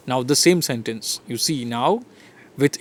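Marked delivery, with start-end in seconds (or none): none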